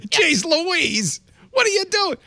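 hammed-up high voice, teasing a co-host; mock exclaim. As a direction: squeak it out like a startled onlooker. Mock reaction to dashcam video.

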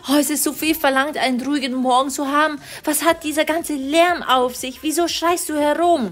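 höhere, genervte Mama-Stimme; Mama schimpft. Acted. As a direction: als aufgebrachte Mutter höher sprechen. (Mutter findet Tochter im Schlafzimmer, höher und genervt)

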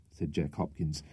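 usual speaking voice

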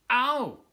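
loud, high cry of pain. Acted. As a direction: yelp as if the tusk stings. Walrus cub hurts his tusk.

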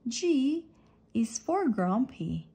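usual speaking voice

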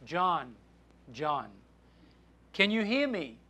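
quiet, low voice from above; soft distant call. Acted. The Lord calls down to stranded John, softly. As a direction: call his name softly, with space between.

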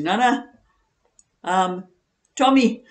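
put-on high, loud child correcting nana; little grandson voice. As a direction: pipe up like a small boy. Grandson correcting nana's name slip.

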